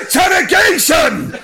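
high, drawn-out theatrical yell; hammed-up inspector's shout. A narrator's reading. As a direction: bellow it like a showy announcement. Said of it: "Inspector Girth shouted".

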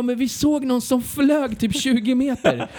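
high-pitched mimicry of excited locals; insistent onlookers. Locals telling the rider what they saw, high excited imitation.

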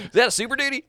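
high-pitched laid-back surfer dude. Retold or imitated. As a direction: ask it like a chill surfer bro. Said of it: "I sounded like a surfer".

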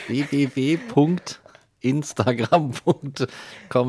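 slow, spelled-out web address; slow announcer read. Pretend. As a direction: announce it slowly like an ad. Reads fake address slowly, louder.

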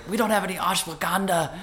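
high, hammed-up exasperated protest; playfully acting out his complaint to his wife; mock exasperation. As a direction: squeak it out in mock disbelief. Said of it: "I'm like".

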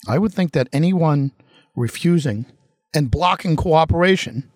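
ordinary talk, nothing marked

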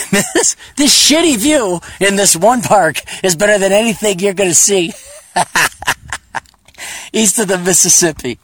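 cracking up mid-sentence; laughing. Real, not acted. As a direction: tease through your own laughs. Cracking up at his own teasing.

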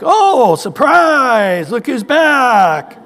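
high, loud sarcastic greeting; hammed-up sneering angel; mocking angel. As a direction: drag out the sarcasm, slow. Angel mocking returning sinner.

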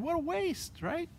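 high, soft mocking sneer; mock scornful. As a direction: scoff at the waste, imitating critics. Voicing the scoffing world.

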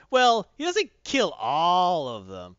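"all" drawn out and loud; slow, loud hedge leaning on "all"; emphatic correction. Real, not acted. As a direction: stretch "all" to qualify the claim. Hedging after listener's surprised question.

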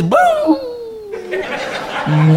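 high laughing imitation of a dog howling "Boo! Boo!". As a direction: howl it like a lone dog at the moon. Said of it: "looks up at the moon and says".

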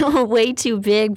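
normal talking voice

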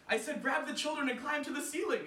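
high, loud exasperated call; cried out. Acted. Anansi repeating to wife, loud. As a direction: repeat it desperately. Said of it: "Anansi cried".

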